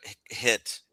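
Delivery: ordinary talk, nothing marked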